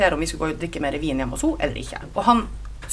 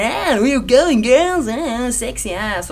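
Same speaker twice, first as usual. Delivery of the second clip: 1 catcalling men; 6 semitones higher, 3 dB louder, much slower than usual. Retold or imitated. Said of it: "og var sånn".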